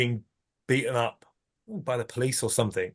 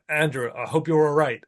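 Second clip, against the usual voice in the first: usual speaking voice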